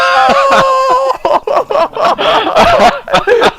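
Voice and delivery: high loud shrieking laughter; laugh-scream at losing. Real, not acted. Laughing scream after death.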